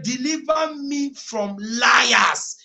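high, loud, very slow shouted scripture; full-force preaching. Acted. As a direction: thunder the verse over the congregation.

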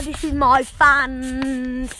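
usual speaking voice

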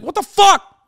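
high, loud exasperated yell; yelled in high-pitched bafflement; incredulous outburst. Acted. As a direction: burst out in disbelief, pitch shooting up. Incredulous reaction to lopsided scores.

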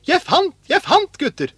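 high, loud cry of discovery; excited shout. A narrator's reading. Askeladden calls to his brothers about a find, shouting. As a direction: call out thrilled at a find. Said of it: "ropte han".

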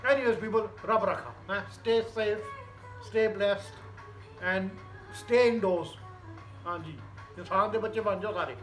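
relaxed Punjabi north indian accent. Real, not acted. Punjabi speaker signing off.

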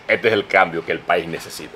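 low, soft attempt at a politician; Abinader impression attempt. Host trying out an Abinader impression, low and subdued. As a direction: lightly try the president's low voice. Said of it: "Yo he querido imitar".

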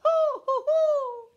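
very high, loud hooting; monkey hoots. A narrator's reading. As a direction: hoot back like cheeky monkeys. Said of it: "they answered him".

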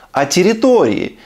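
normal talking voice

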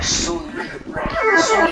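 slow drawn-out British Sully; British accent. Acted. Friend mocks a name in a slow British accent. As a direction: say Sully slowly like a British boy. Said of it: "Yeah, that's British".

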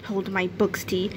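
ordinary delivery, nothing marked

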